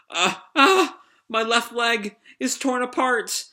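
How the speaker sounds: very high, slow lament; whimpered gasps into a high, halting cry; distressed android. Acted. Pino reacts to torn-off leg.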